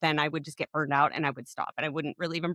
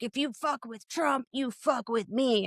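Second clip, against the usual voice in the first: higher-pitched, held-back mock yell; put-on tough-guy threat, pitched up; 5 semitones higher, 4 dB quieter than usual. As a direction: imitate a blustering tough guy, without real volume. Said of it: "super tough guy in his phone".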